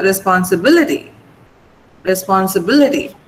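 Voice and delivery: "responsibility" with the BIL syllable stressed, pitch falling after it. Shown as a demonstration. Teacher modelling "responsibility" for a student, stress on BIL. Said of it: "you have to stress the B".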